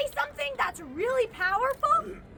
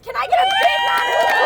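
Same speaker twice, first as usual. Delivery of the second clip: much higher, louder and fast; hype call; 11 semitones higher, 7 dB louder, faster than usual. Real, not acted. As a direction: whip up the crowd's applause. Calling for applause at rally.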